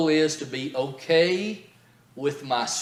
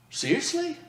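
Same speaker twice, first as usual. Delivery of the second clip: much higher, amazed "Seriously?"; stunned disbelief; 13 semitones higher than usual. Retold or imitated. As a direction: squeak it out in wide-eyed surprise. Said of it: "her eyes popped up wide, and she went".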